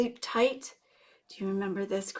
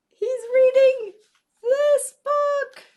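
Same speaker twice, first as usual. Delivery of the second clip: much higher, louder, delighted; high excited voice; 8 dB louder, slower than usual. Acted. Reading to kids, thrilled about the axolotl's book. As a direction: squeal it with delight.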